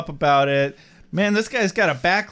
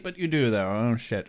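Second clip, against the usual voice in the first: ordinary talk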